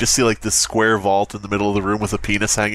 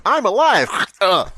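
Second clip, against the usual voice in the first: hammed-up cry; 10 semitones higher, 4 dB louder than usual. Playing Slicey in routine.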